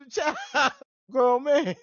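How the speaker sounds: laughing, high indignant protest. Real, not acted. Grown man laughing at being called a child, high and loud. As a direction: laugh through the outrage.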